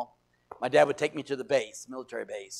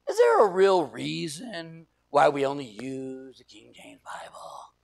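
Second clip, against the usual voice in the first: slow, drawn-out skeptical challenge; pointedly questioning authority; challenging questioning; 3 dB louder, slower than usual. Shown as a demonstration. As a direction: stretch it out, loud start sinking to a hush. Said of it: "There's two different tones".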